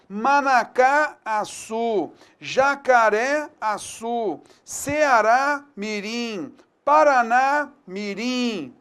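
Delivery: each name said slowly and clearly, the stressed vowel leaned on; slow dictation. Shown as a demonstration. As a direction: read the list out slowly like dictation. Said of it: "tem um sinal gráfico". (lista ditada devagar, marcando a sílaba tônica)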